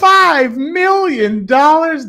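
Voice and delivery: high, loud, slow stretched question; mock astonishment. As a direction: ham up the disbelief. Echoing in mock amazement.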